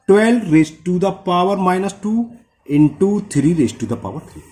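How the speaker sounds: slow, careful reading of the expression; slow dictation. Shown as a demonstration. Teacher dictating the problem to students, slow. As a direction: dictate it term by term.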